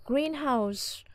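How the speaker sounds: greenhouse with GREEN stressed, falling after; stress on first syllable. Shown as a demonstration. Teacher models compound greenhouse, stress on green. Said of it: "trọng âm rơi vào âm tiết đầu tiên".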